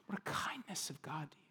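soft, intimate whisper. Real, not acted. Pastor whispering God's kindness to the congregation. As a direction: lean in and breathe it to them.

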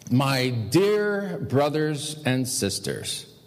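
"dear brothers and sisters" drawn out slowly; slow, deliberate. Shown as a demonstration. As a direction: repeat the address slowly, dwelling on "dear". Repeats the address for emphasis.

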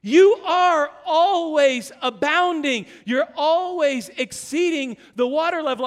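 loud, slow, punched proclamation; slow emphatic preaching. Acted. Pastor declaring identity to the congregation, slow and loud. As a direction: hammer each line home.

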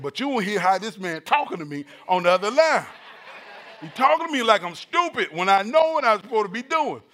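mock-aggrieved protest, building; indignant complaint. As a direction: complain to your wife about being disrespected. Indignant complaint retold to wife.

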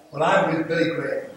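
ordinary delivery

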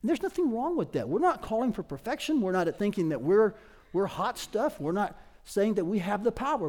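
ordinary delivery

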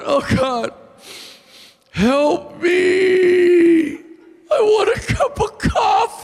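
high, loud whiny wail to God; whiny prayer. Retold or imitated. Preacher acting out his coffee-fast prayer, high whiny wailing. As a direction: beg for coffee like a whiny baby. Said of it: "I'm a whiny baby at prayer".

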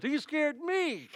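high, drawn-out comic protest; exaggerated high-pitched retort; mock-frightened comeback. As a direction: play up the fright for a laugh. Retelling his comeback for laughs.

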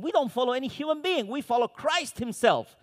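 high, quick, self-righteous voice; mock the proud spiritual crowd; smug pious quote. Proud group quoted mockingly.

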